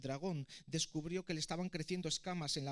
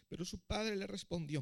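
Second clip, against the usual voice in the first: plain reading voice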